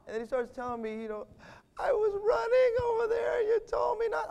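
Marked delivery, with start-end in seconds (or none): 1.8-4.3 put-on high small-boy voice; tearful toddler. Father voicing his son confessing, high child voice. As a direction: explain it like a sniffling three-year-old.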